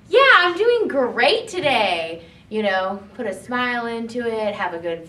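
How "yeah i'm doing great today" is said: higher, louder, slow and cheerful; upbeat. Shown as a demonstration. Teacher showing how a real good sounds, upbeat. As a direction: put a smile into it. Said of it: "A good would more be like".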